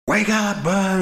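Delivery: high-pitched mock wake-up call; retold urgent wake-up. Father to his girl asleep in the tub, high urgent call. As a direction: imitate calling out to rouse a sleeper. Retells waking child in tub.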